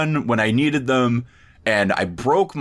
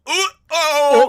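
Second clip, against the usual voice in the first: high squeaky uh-oh, louder; a caught-out uh-oh, pitched way up; mock uh-oh; 5 dB louder than usual. Caught talking about Erin.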